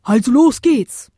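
hearty, high call to go; rallying shout. A narrator's reading. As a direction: shout them into action. Big wind sets off.

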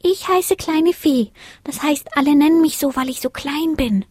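high, tiny, timid voice; shy little star. A narrator's reading. Smallest star admits her name, shy. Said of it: "gab es schüchtern zu".

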